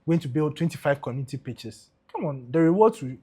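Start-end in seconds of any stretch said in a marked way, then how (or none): none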